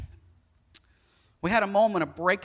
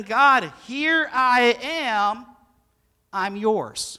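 surrender prayer; 6 semitones higher, 8 dB louder, much slower than usual. Pretend. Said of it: "It's saying".

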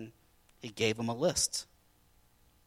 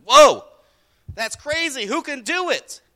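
much louder, higher gasping whoa; astonished outcry; 11 semitones higher, 13 dB louder than usual. Acted. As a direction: cry out in disbelief. Disciples shocked again.

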